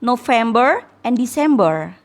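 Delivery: each month rising, recited to the class; reciting list. Shown as a demonstration. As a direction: chant through the months like a drill. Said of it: "Jadi bulan itu kalau dalam bahasa Inggris". (membacakan nama bulan berirama)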